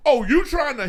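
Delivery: high, loud challenge; mock confrontation. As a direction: puff up like someone picking a fight. Voices someone starting a fight.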